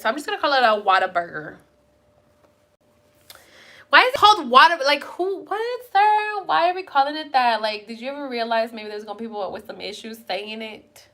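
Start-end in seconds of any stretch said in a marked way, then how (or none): 3.9-7.6 exasperated. Real, not acted. Mock complaint at restaurant namer.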